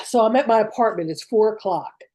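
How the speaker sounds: usual speaking voice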